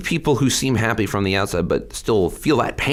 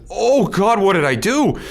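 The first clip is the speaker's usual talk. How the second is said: much higher, alarmed voice; mock panic; 13 semitones higher, 4 dB louder, slower than usual. Recalling the moment reality hit, a high panicked cry. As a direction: replay the sudden dread of what you've done. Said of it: "I went".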